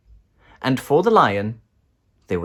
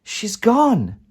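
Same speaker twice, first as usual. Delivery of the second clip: cried out; 12 semitones higher, 5 dB louder than usual. A narrator's reading. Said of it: "cried Dorothy".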